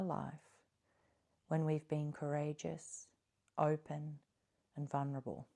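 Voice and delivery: soft, calm meditation voice with long pauses; guided meditation. Acted. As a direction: gently guide listeners inward, leaving space between phrases.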